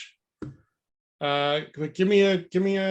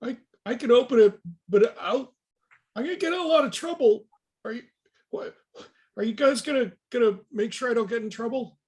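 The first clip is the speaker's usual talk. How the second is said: nervous pleading; 7 semitones higher, faster than usual. Acted. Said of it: "So you hear the voice say".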